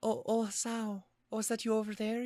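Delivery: high, put-on Scottish lady's voice; Scottish accent attempt. [name] greeting Hasao, attempted Scottish accent. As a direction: try a high-pitched Scottish lilt. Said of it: "since I've done a Scottish accent".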